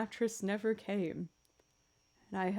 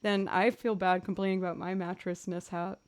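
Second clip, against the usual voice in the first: normal talking voice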